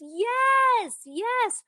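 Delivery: very high, eager yes yes; doctor's delighted high-pitched yes yes; excited yes. Retold or imitated. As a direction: gush it like a thrilled doctor. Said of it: "And she goes".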